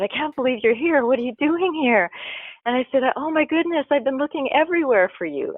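high, breathless excitement, voice jumping up; excited. Retold or imitated. Telling girlfriend he can't believe she's here, excited. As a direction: gush with disbelief at seeing her. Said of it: "I got all excited".

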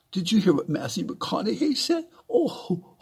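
high-pitched starstruck gushing, mocked; mock awe. Mimicking people in awe of a celebrity, mock awe. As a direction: gush like a star-struck fan, hammed-up. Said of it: "all in awe of them".

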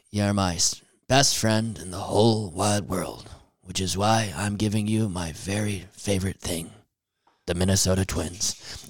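soft, low, mock-sentimental grandpa; quietly bequeath it with acted tenderness; tender farewell. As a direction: imitate a dying grandpa, hushed and low. Grandpa's farewell in video will.

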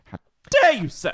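high, loud fake offense; hammed-up indignation; mock outrage.

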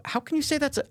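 high-pitched, disbelieving question; incredulous. Retold or imitated. Asking the video's makers in disbelief, high. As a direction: jump up high on "how can you say". Retold reaction to racist line.

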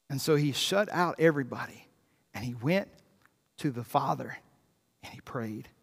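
soft, low voice fading away; hushed reverence. Real, not acted. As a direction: sink gradually into a quiet, low close. Hushed account of private prayer.

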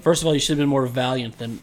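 ordinary talk, nothing marked

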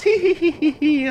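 high mad giggle; giggling. Acted. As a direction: giggle like a deranged girl. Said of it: "[name] laughs".

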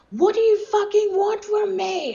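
a desperate, cracking howl at the heavens; screaming at the sky. Retold or imitated. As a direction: scream it up at the sun in despair. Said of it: "I just started screaming at the sun".

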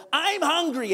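put-on high whining child's complaint; whiny kid. Kid pestering parent while shopping, whiny. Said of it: "with the most whiny voice".

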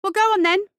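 high, loud reckless challenge; furious young underdog talking back; indignant fury. A narrator's reading. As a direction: dare the bully, voice pitched right up. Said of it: "A surge of indignation and fury".

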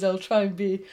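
usual speaking voice

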